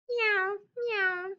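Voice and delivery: high, mewing meow; cat meow. Acted. Teacher reading the farm book, a high cat meow. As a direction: mew like a little cat.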